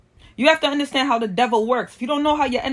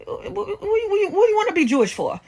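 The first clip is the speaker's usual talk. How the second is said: mock disbelief; 8 semitones higher than usual. Mock incredulous reply.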